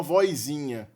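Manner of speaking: "vozinha" pronounced as "voizinha"; an i slipped before the z of "vozinha"; inserted i glide. Shown as a demonstration. Teacher showing a form grandmothers can't take, i glide in "vozinha". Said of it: "a gente não pode falar".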